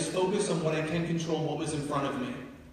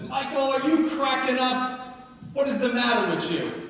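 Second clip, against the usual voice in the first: loud, high-pitched scolding New York mom; New York accent; 10 semitones higher, 7 dB louder than usual. Retold or imitated. Mom to son who wants to drop out, scolding New York accent. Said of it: "In a New York accent".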